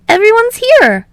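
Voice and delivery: high, loud, smiling voice; bright cheerful call. A narrator's reading. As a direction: announce it with sunny delight. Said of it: "smiling brightly".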